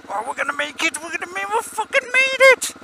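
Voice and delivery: rising to a high triumphant yell; building from hope to a whoop; excited. Real, not acted. Squeezing through traffic, triumphant.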